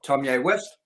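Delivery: ordinary delivery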